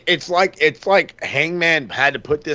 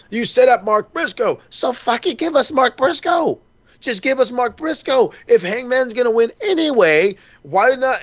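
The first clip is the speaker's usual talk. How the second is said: much higher pitched, pleading push on each line; heated rant; 8 semitones higher than usual. Real, not acted. Fan host begging the company for Mark Briscoe, heated and high. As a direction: demand it like an exasperated fan.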